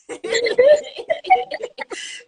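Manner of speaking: high burst of laughter; loud delighted laugh; big laugh. Real, not acted. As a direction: crack up laughing. Host laughs at guest's English.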